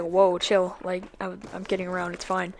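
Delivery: soft, low-key backing off; retold calming down. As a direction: play it down quietly to an angry friend. Retold attempt to calm angry friend.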